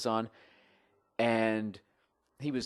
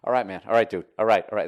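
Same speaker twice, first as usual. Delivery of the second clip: impersonator dropping character; 9 dB louder, faster than usual. Retold or imitated. Fake [name] to buddy, voice changing out of character. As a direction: let the put-on voice give way, forcefully. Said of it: "he's kinda dropping character".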